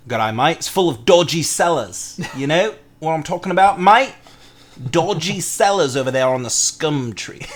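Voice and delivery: jokey amateur Australian; bad Australian accent. Warning a mate about dodgy sellers, mock Australian accent. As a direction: try a hammy Australian, amused. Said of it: "there go all of our Australian viewers".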